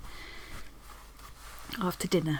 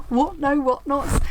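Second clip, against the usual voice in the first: higher, slow, delighted what no whatnots; surprised exclaim; 5 semitones higher, 5 dB louder, slower than usual. Real, not acted. Crafter spotting the old Chad doodle, surprised exclaim.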